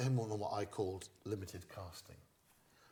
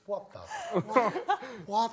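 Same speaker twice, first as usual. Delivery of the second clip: high, loud baffled cry; baffled audience; 12 semitones higher, 10 dB louder, slower than usual. Retold or imitated. Comic voicing the baffled audience, a loud high What. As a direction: shout confused disbelief. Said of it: "The audience".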